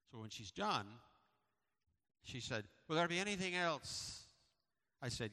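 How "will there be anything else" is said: Scottish clerk voice. Retold or imitated. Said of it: "she's got this accent".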